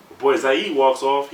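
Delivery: ordinary talk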